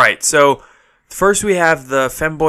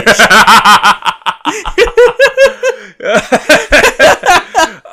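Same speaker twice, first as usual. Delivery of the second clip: loud laughter; 11 dB louder than usual. Real, not acted. Cracking up at the joke.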